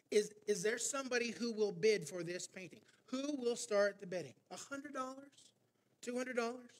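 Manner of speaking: auctioneer calling out bids. Retold or imitated. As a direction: call to the room like an auctioneer.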